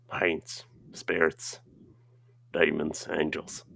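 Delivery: ordinary delivery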